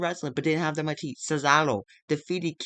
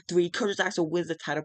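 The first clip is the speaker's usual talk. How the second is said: ordinary talk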